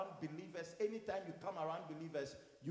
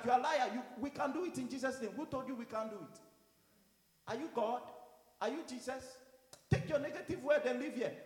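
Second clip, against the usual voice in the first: raised, higher-pitched scolding; retold rebuke; 6 semitones higher, 3 dB louder than usual. Pastor answering doubting church members, retold indignant rebuke. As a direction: challenge them in mock indignation.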